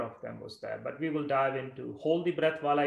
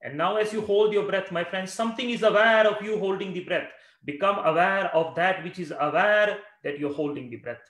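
preaching; 5 semitones higher, 7 dB louder than usual. Acted. Urgent call while breath held.

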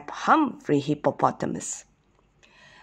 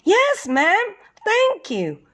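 little hippo voice; 11 semitones higher, slower than usual. A narrator's reading.

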